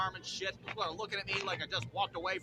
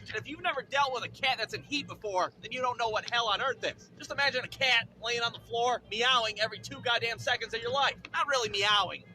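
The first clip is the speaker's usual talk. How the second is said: normal talking voice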